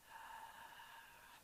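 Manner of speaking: long, soft airy exhale; imitated last breath. Reviewer imitating the sound from the cocoon scene, last breath. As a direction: let the air seep out like a dying breath. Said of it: "it was, like, her last breath".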